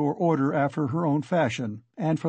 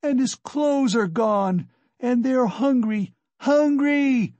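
high, slow wail of despair; anguished high outcry, building to the last word; despairing scream; 10 semitones higher, 5 dB louder, slower than usual. A narrator's reading. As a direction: cry out desperately for the hungry children. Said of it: "screamed in despair".

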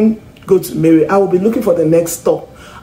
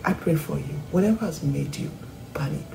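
hushed, softer pastoral prayer; 6 dB quieter than usual. Acted. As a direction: pray over them gently and quietly. Soft intercessory prayer.

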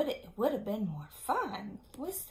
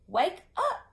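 high, loud call to wake them; 8 semitones higher, 8 dB louder than usual. Acted. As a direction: rouse the sleeping cats. Nick waking sleeping cats.

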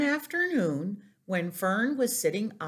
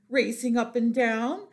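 ordinary delivery

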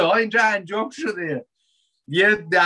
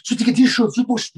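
impatient rushing; 4 semitones higher, much faster than usual. Retold or imitated. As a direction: hurry someone along, impatient.

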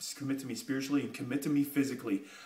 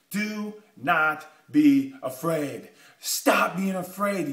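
slow emphatic urging; 4 dB louder, much slower than usual. Acted. Preacher urging listeners past fear.

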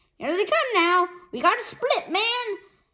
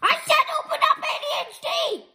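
angry shout; 11 semitones higher, faster than usual. Acted. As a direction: shout the order at the robot. Kid yelling at robot.

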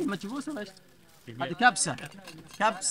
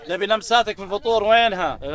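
calling out; 5 semitones higher, 8 dB louder than usual. Real, not acted. Teasing call across camp.

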